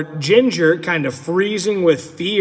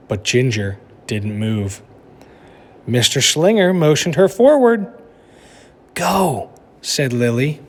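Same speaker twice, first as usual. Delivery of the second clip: lively storybook narration for a class; reading aloud to children; 2 semitones lower than usual. A narrator's reading. Teacher reading a picture book to her class, animated storytelling. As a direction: read it like story time for kids.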